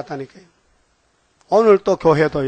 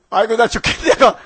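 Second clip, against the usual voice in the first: panicked donkey cry; 5 semitones higher, 4 dB louder than usual. Retold or imitated. Donkey cries out on the bridge.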